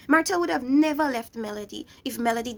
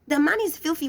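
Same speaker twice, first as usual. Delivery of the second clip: usual speaking voice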